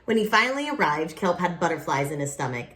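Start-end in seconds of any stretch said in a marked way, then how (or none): none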